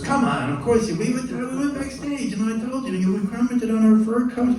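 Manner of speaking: high, loud imitation of protest; incredulous insisting. Singer protests to composer about forgotten meeting, incredulous imitation. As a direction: argue it with pitch jumping way up.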